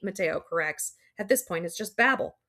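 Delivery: ordinary delivery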